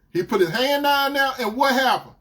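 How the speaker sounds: put-on outraged boyfriend. Boyfriend confronting her confession, outraged. Boyfriend reacts and demands more.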